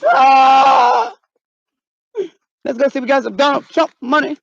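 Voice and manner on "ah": long, loud mock-terror scream. Woman scared of Bigfoot, loud hammed-up scream.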